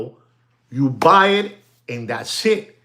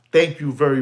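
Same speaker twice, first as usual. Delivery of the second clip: usual speaking voice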